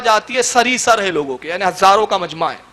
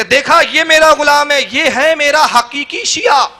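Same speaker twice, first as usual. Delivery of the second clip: higher, slow and deliberate on یہ ہے میرا حقیقی شیعہ; proud declaration; 6 semitones higher, 3 dB louder than usual. Retold or imitated. Preacher quoting the Imam, proclaiming slowly. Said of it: "امام جعفر کہتے".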